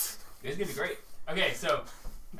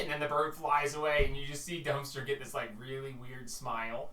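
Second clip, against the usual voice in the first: normal talking voice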